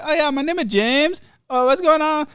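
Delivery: very high mock Vietnamese accent; hammed-up, high Vietnamese-accented phone agent; James. Phone agent greets frustrated caller, mock Vietnamese accent. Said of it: "That's my impersonation of James".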